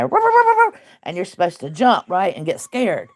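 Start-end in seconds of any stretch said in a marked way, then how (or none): 0.0-0.8 high yappy little-dog barks; squeaky mock chihuahua yapping. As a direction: bark like a tiny chihuahua. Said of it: "they go".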